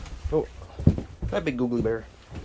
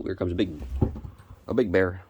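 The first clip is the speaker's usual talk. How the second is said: ordinary talk, nothing marked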